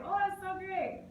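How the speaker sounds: high, mock-gushing teenager; gushing teen. Teacher mimicking students bragging about followers, mock-gushing. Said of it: "You know, like".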